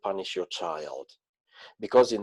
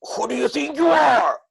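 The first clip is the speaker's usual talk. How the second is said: much higher, louder furious demand; angry shout; 13 semitones higher, 4 dB louder than usual. Shown as a demonstration. Speaker showing how not to confront someone, angry shout. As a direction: demonstrate the wrong, angry way of setting a boundary. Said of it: "not from anger".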